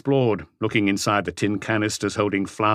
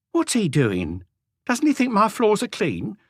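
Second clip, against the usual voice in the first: higher, dismissive woman's voice; a sulky wife scoffing at authority; scornful housewife; 12 semitones higher than usual. A narrator's reading. Renée offended.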